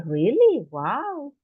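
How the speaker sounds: Really rising high in amazement; surprised. Shown as a demonstration. Teacher acting a listener amazed by El Pital. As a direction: sound amazed at the news. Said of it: "I would say".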